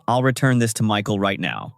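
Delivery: ordinary delivery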